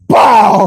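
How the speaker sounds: loud, high explosive "bow"; imitated kick impact. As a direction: punch out the sound of a blow.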